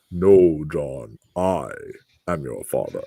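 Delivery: low, hushed, ominous movie-father voice; James Earl Jones. Retold or imitated. Imagining the actor in the part for co-hosts, deep threatening voice. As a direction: drop deep and soft, pausing for menace. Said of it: "he's gonna just be like".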